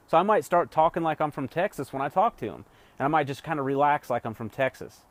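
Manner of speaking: ordinary talk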